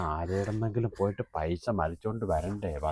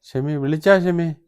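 loud, slow, higher call across the house; 8 semitones higher, 10 dB louder, slower than usual. Acted. Father calls out to Shemi from afar, loud slow call. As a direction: call out to her from another room.

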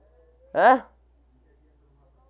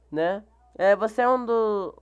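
ordinary delivery

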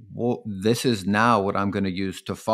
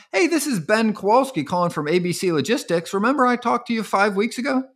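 bright, high pushy sales pitch; salesy cold caller; 10 semitones higher than usual. Shown as a demonstration. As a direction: play the cheesy cold caller. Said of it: "What do I sound like?".

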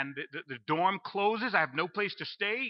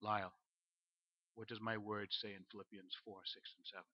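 lower, much softer and calm; voice of God; 8 semitones lower, 14 dB quieter than usual. Acted. God gently questioning the panicking young Lyle, quiet and calm. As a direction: speak as a still small voice. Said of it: "the word of God comes".

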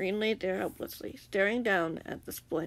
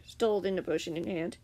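usual speaking voice

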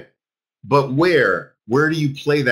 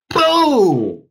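loud, high burst of triumph; excited shout; 11 semitones higher, 6 dB louder than usual. Real, not acted. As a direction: slam the word out like a win. Excited trading teacher exclaims.

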